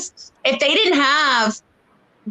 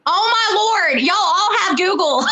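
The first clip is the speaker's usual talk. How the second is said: laughing shout; 6 semitones higher than usual. Real, not acted. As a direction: call it out laughing at the chat.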